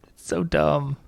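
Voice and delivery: breathy whisper, laughing. Real, not acted.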